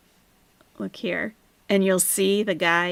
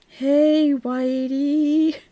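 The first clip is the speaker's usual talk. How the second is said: high, long drawn-out call; mock greeting; 7 semitones higher, 3 dB louder, much slower than usual. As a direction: sing out the greeting mockingly. Mimicking the streamer's greeting.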